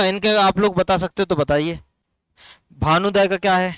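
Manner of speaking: usual speaking voice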